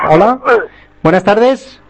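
loud greeting. Real, not acted. Greeting a caller on air.

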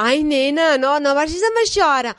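put-on posh Catalan accent. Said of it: "el catalán pijo es de esta manera".

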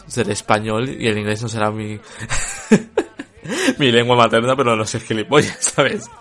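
laughing through an indignant comeback; laughing retort. Retold or imitated. Reviewer answering a rude Goodreads commenter, laughing indignantly. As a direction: scoff with a laugh while you say it.

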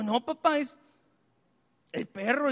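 lightly acted tearful child; imitated tearful daughter. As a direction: mimic a crying girl getting words out. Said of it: "cuando estás llorando".

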